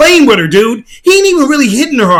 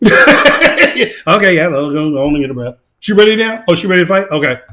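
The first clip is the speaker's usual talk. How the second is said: imitating the fighter; 8 semitones lower than usual. Male fighter letting the woman catch her breath, low and mocking. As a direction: lightly voice him going easy on her. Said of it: "He kept like".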